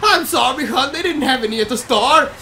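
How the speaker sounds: a hammed-up Irish wife; mock Irish accent; mock Irish apology. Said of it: "that's not what they sound like?".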